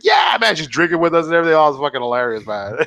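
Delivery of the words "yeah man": cheerful shout. Retold or imitated. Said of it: "he's like".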